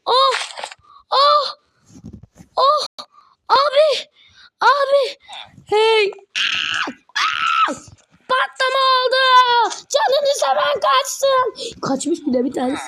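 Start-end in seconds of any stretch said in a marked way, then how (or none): 0.1-11.5 shrill yells of alarm; high excited shout. Real, not acted. Shouting warnings after the explosion, high yell. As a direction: yell everyone run.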